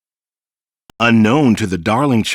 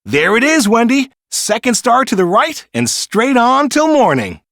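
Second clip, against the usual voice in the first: high, louder thrilled calling; eager pointing out; 10 semitones higher, 4 dB louder than usual. A narrator's reading. Peter showing Wendy the way, high thrilled call. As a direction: point ahead with wonder.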